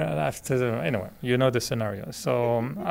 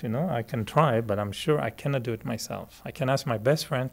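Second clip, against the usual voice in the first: normal talking voice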